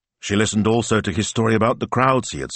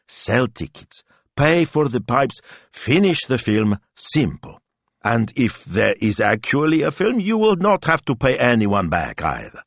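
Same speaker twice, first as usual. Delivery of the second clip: higher-pitched wife, deliberate list; practical wife; 5 semitones higher than usual. Acted. Wife lists her plan to husband, practical. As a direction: tick off each step, pitched up.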